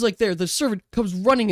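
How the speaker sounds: usual speaking voice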